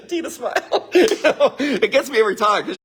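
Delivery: helpless chuckles breaking into the words; amused laughing. Real, not acted. Husband tickled by his wife's comeback, laughing as he talks.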